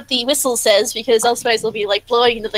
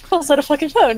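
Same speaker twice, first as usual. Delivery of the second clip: ordinary talk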